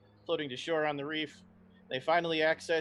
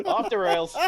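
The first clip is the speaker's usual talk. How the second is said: amused repeat; 4 semitones higher, 10 dB louder than usual. Real, not acted.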